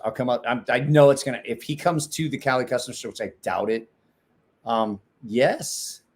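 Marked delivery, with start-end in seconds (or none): none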